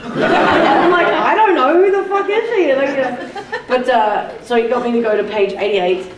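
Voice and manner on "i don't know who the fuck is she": high-pitched comic disbelief; mock bafflement. Actress echoing the agents' question about herself, mock baffled. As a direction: voice leaps up as if utterly clueless.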